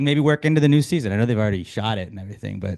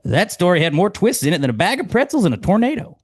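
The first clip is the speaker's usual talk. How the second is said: higher, louder folksy quip; Ted Lasso quote; 9 semitones higher, 6 dB louder than usual. Acted. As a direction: sell the punchline brightly.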